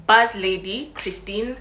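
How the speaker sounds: ordinary talk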